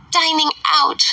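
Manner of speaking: high, fluttery mother's voice; fussy Mrs Bennet. A narrator's reading. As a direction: play the indignant silly mother.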